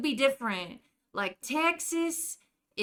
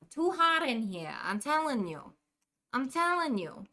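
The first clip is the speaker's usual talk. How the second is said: put-on complaining in a New Jersey accent; a light Jersey grumble. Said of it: "how am I doing?".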